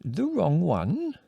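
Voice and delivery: slow, high exclaim of surprise; drawn-out puzzled cry. A narrator's reading. Old village woman startled by the request, exclaiming. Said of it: "she exclaimed".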